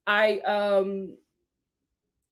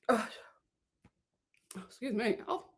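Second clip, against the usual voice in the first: coughing, choked up on water; sputtering after water went down wrong; choking on water; 11 dB quieter, faster than usual. Real, not acted. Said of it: "I'm choking on water".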